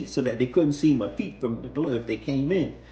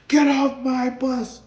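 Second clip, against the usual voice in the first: higher and louder, slow angry order; driver yelling; 9 semitones higher, 6 dB louder, slower than usual. Retold or imitated. Driver ordering the rider off, angry slow yell. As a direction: bark him off the bus.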